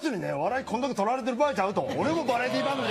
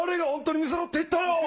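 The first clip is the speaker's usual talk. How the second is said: fast, higher comic retort; mock retort; 7 semitones higher, faster than usual. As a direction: cut him off with a laugh in the voice. Turtle retort.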